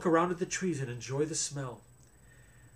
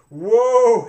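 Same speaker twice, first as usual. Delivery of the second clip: loud high whoa; loud jolted whoa; jarred cry; 8 semitones higher, 12 dB louder than usual. A narrator's reading. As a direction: blurt it out as you bounce. Ellen bouncing on a trotting horse.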